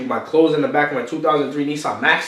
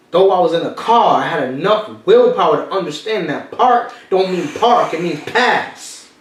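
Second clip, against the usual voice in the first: louder and higher with a laugh; preaching build; 6 semitones higher, 5 dB louder than usual. Real, not acted. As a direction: fire them up with the lesson. Motivational peak, host reacting.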